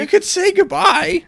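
high, slow, scolding cry. Real, not acted. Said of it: "scolding Haley".

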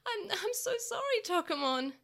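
put-on high, stammering tearful boy; crying apology. Takeru apologizing to Tokomon, a touch of tears. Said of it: "he cried as he apologized".

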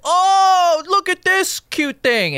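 high, loud gushing tourist caricature; mock American tourist. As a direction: coo loudly like an oblivious tourist. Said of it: "we go to other countries and then we go".

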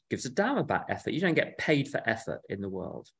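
ordinary delivery

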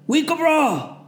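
high loud reply; shouted reply. A narrator's reading. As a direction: answer the corporal. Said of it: "Connor responded".

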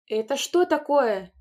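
mother explodes at the sight; angry shout. A narrator's reading. Mother to the children, angry shout. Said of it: "сердито закричала она".

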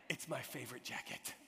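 low, soft acted objection; quiet protest. Man to his friend over a left jacket, quietly protesting. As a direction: drop it low and quiet, mock-earnest.

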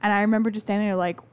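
ordinary delivery

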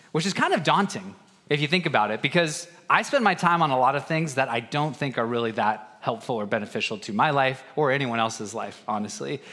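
ordinary talk, nothing marked

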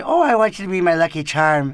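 put-on loud leprechaun-style Irish accent; exaggerated mock Irish come-on; cartoon Irish. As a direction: ham up a cereal-mascot Irish brogue. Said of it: "I didn't go the cartoon Irish".

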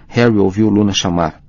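ordinary delivery

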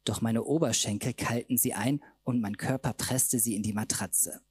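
ordinary delivery, nothing marked

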